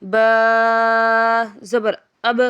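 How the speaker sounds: slow chant, letters drawn out then joined; Qaida spelling drill. Shown as a demonstration. Teacher chanting letters for pupils, drawn-out sing-song spelling. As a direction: spell it out for a child learning Quran letters.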